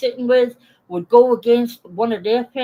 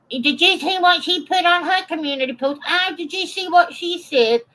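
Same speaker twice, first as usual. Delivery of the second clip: high, mocking gossip voice; mock-excited gossip about her post; gossiping mimic; 9 semitones higher than usual. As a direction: squeal it like a catty gossip. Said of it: "group calls and fucking".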